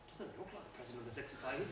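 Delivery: a quick doubtful mutter to yourself; skeptical aside. Retold or imitated. Sizing up a self-declared president.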